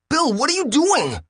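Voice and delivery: high, alarmed alien cry. A narrator's reading. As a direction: cry out in surprise, pitched high. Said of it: "cried Brown Nose".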